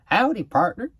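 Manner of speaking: loud, high cowboy Texas twang; Texas accent. Pretend. Vlogger greeting Texas viewers in a loud Texas accent. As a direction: call out like a hammed-up Texan. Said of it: "I'm using a Texas accent".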